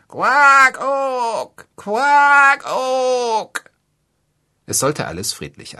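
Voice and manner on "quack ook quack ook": crow cawing. A narrator's reading. Crow answers in bird call.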